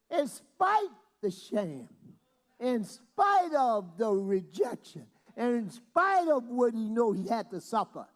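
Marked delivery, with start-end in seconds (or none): none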